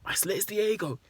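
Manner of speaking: high-pitched weekday afternoon DJ; hammed-up, high radio announcer voice; radio presenter impression. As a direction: put on a daytime radio host introducing a new artist. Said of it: "some next weekday four P M impression".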